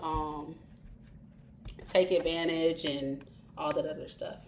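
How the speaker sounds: ordinary talk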